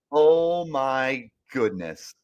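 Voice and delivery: ordinary talk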